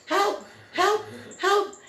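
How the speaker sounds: high-pitched mock cries for help; imitating screaming daughter. Mom imitating her daughter dragged by the foal, screaming. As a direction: squeal each word out like a comic cry for rescue. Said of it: "my daughter was screaming".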